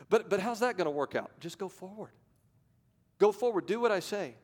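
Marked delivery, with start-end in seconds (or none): 1.4-2.1 "Just go forward" dropped much softer; quiet insistence. Acted. God repeats command to Moses. 3.2-4.3 firm command. Acted. God repeats command to Moses.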